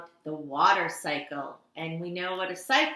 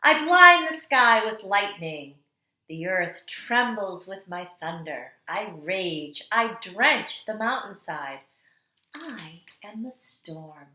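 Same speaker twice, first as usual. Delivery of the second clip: storytelling read-aloud; 3 dB louder than usual. Acted. Teacher reading The Water Dance to kindergarteners, dramatic storytelling. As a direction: read it like the water itself is dancing. Reads picture book aloud.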